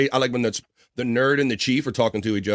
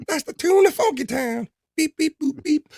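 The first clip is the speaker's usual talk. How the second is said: squeaky cartoon voice. Goofy high-voice Funky Town bit.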